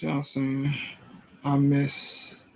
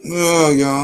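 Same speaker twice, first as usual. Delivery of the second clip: frustrated outburst; 9 dB louder than usual. Real, not acted. Streamer frustrated by broken link.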